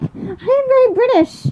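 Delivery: high, plummy mock British accent; posh British impression. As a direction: ham up a very British lady. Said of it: "and then she's like".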